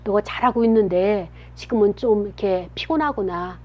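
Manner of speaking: put-on a parent softly comforting a grumpy child; soothing parent. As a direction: soften right down, like a parent reading a child's heart. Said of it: "엄마, 아빠가 옆에서 여러분의 마음을 읽어줘야".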